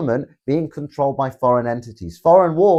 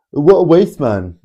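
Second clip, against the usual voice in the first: mock roadman; 3 semitones higher, 8 dB louder, slower than usual. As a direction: mimic the roadman insult, pitched right up. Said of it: "thanks, Mister Roadman".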